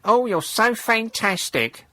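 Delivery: slow, high, gushing praise; fawning flunky. Pretend. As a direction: swoon over him mockingly. Mocking a fawning supporter.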